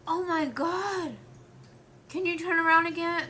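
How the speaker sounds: put-on high, loud, amazed child voice; excited little girl. Little girl amazed at her, asking her to turn around, high excited voice. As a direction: gush like a starstruck little girl. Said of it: "the little girl was like".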